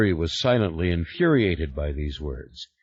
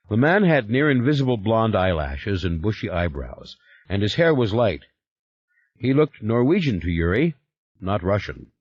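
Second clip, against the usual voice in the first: ordinary delivery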